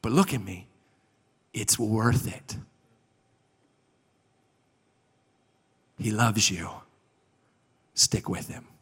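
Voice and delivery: quiet words with long pauses between them; tender reassurance. Retold or imitated. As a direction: gently urge a struggling friend to hold on. Older man reassures younger man.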